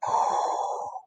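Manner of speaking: soft airy exhale; breath blown out. Acted. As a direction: blow the breath out. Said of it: "Pete blows his breath out".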